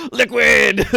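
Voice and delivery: excited shout. Real, not acted. Excited shout of character name.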